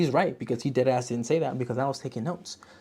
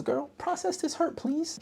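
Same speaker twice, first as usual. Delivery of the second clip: put-on much higher, pleading girlfriend voice; high sassy plea; 4 dB quieter, slower than usual. Viewer reading her note to the heroine, high and pleading. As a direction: beg her like a sassy bestie. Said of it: "my next note says".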